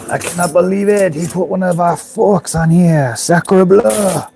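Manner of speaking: high, put-on French outrage; a hammed-up Frenchman spotting his forks; mock French. Imagined French fork owner upset in a mock French accent. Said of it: "People are gonna be like".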